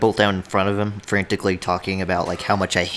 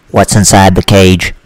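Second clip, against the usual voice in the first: loud slow drawn-out yell; 3 semitones higher, 14 dB louder, slower than usual. Acted. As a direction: bellow the question. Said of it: "He yells into the mic".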